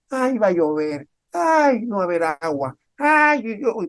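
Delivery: high whiny "ay" moans; mock complaining. Preacher mimics a gloomy man opening his window, whiny high moans. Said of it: "has abierto la ventana".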